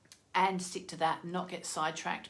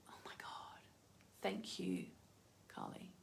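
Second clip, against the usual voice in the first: awed whisper; 7 semitones lower, 19 dB quieter than usual. Real, not acted. Moved by card message.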